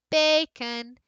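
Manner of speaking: slow, sing-out BAY-kun; calling-the-dog stress. Shown as a demonstration. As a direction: call bacon like a dog's name, stretching BAY. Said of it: "that bay that's longer is also higher".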